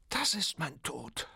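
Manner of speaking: high, slow cry of doom; a lament of certain death; despairing cry. A narrator's reading. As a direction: wail it as a blinded, doomed man. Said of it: "brüllte Turiddu geblendet".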